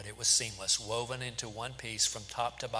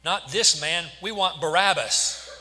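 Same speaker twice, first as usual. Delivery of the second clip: loud, higher crowd shout; shouting back; 6 semitones higher, 10 dB louder, slower than usual. A narrator's reading. The crowd answering Pilate, shouting back. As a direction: shout it back in emphatic, punched words. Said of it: "They shouted back".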